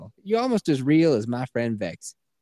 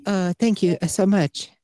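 high, halting put-on Chinese accent; caricatured Chinese-accented thanks, higher pitch; mock Chinese accent; 7 semitones higher, 4 dB louder than usual. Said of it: "Chinese woman?".

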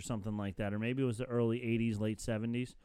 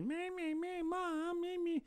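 very high, thin whining, softer; whiny kid; 6 dB quieter, slower than usual. Retold or imitated. Mocking Caillou to co-host, high whiny kid voice. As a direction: whine it like a pestering child. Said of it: "He's so whiny".

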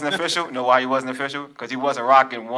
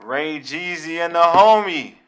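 announcer voice; 3 semitones higher, 3 dB louder, much slower than usual. Acted. Show intro announcer read.

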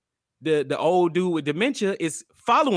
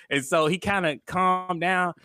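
ordinary talk

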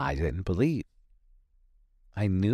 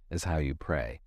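ordinary talk, nothing marked

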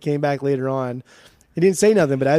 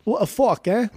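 put-on higher-pitched botched British accent; awful British impression; 8 semitones higher than usual. Mocking British record buyers to a co-host, awful British accent. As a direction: attempt British, landing almost in New York. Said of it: "that was an awful British impression".

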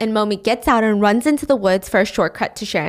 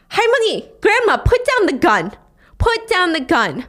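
high, raised commanding voice; police over intercom; 7 semitones higher than usual. Retold or imitated. Swat team to grandma with shotgun, called-out command. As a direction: call the order out across a distance. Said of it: "the swat team use the intercom".

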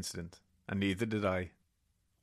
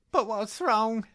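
higher and louder panicked question; frightened wife; 14 semitones higher, 6 dB louder, slower than usual. Acted. Wife begging husband to explain, high and frightened. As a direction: plead with him, high and scared.

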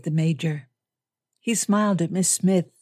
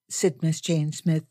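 usual speaking voice

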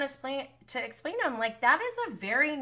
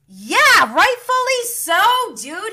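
loud, high-pitched outburst; outraged agreement; 11 semitones higher, 10 dB louder than usual. Real, not acted. Viewer reacting to his excuse, outraged. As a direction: cry out in indignant agreement.

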